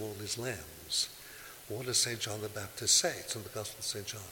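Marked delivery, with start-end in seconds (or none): none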